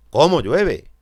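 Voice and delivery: "Cómo" punched high and loud as an exclamation; exclamatory stress. Shown as a demonstration. Teacher giving an example of exclamative cómo, stressed on "Cómo". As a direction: exclaim it, hitting the first syllable of "Cómo". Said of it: "Exclamativo, tónica".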